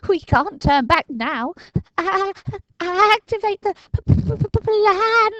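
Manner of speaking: put-on high, stammering, frightened boy; shaking with fear. A narrator's reading. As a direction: force the words out through fear. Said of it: "shaking with fear".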